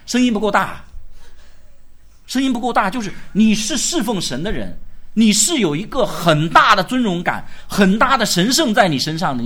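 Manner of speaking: loud, forceful pulpit delivery; impassioned sermon; fiery preaching. Acted. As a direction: drive each phrase home to the congregation.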